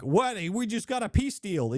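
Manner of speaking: high, mocking voice of a naive critic; mocking whiner. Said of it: "people out there that are like".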